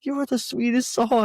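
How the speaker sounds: high, loud gushing voice; hammed-up sweet thanks; imitated gushing gratitude. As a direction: gush it high, as a thankful customer. Voices the grateful customer.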